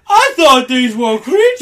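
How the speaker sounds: surprised outburst. Real, not acted. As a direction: blurt it out in shock.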